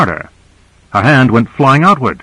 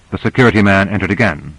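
plain reading voice

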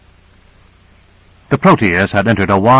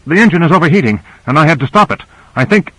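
higher-pitched, worried report; anxious; 6 semitones higher, faster than usual. A narrator's reading. Pilot tells crew he had to stop the engine, anxious. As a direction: report the breakdown uneasily. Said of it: "Owen said anxiously".

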